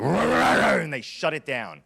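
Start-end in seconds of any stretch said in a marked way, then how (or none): 0.0-0.7 high, loud mock crowd chant; mock crowd uproar. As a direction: ham up an online mob shouting her down. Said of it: "The rest of the community was like".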